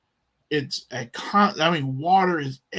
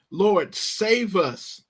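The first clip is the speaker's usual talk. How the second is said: slow, pleading and higher; urgent plea; 5 semitones higher, slower than usual. Retold or imitated. Frightened disciples begging Jesus to save them, slow and urgent. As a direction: beg him to save them, drawn out. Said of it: "there's urgency here".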